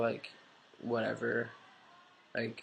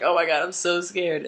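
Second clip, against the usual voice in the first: loud, high, worked-up outburst; acting out nervous excitement; retold panic; 7 semitones higher, 9 dB louder than usual. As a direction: blurt it out in excited nerves. Retold panic before coming out.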